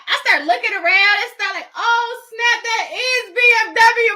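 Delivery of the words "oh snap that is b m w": high, loud, laughing oh snap; laughing realization. Real, not acted. On realizing the wrong dealership, laughing out loud. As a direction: crack up at your own mistake.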